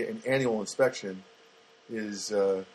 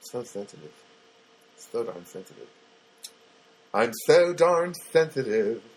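put-on sensitive voice. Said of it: "why am I talking like this?".

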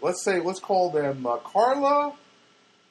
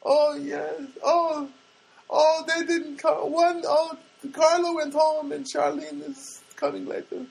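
high, drawn-out mock foreign-girl voice; put-on high voice, slow dawning realization; puzzled foreign girl; 11 semitones higher, 3 dB louder, slower than usual. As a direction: imitate a shopgirl slowly working it out. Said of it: "look, look puzzled".

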